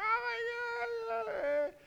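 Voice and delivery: high, slow wail of panic; mock-panicked "Oh my God!" cry. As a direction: cry out like a parent who lost a child. Parent panics at losing child.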